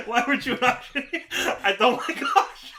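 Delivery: giggling high through the words; laughing. Real, not acted. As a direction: laugh while you say it. Laughing at own joke.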